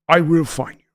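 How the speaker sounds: a hint of Liam Neeson, voiced; lightly voiced movie threat, steady. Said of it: "I'd love to do Liam".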